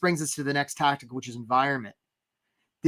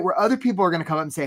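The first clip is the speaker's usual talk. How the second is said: ordinary delivery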